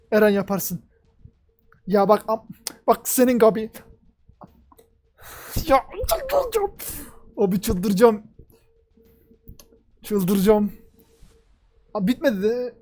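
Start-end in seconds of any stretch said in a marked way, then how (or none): 5.7-6.7 very high, slow, stretched-out wail of frustration; frantic squeal. Real, not acted. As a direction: let it rise into an overwhelmed squeal. Freaking out at the episode. (çok tiz, uzatılmış çaresiz feryat)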